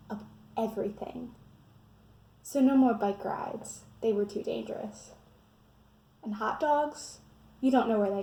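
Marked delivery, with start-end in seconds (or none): none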